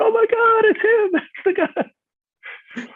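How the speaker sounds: very high, loud mock squeal of recognition, last words drawn out slowly; mock amazed cast. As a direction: squeal like starstruck fans. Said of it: "they all went".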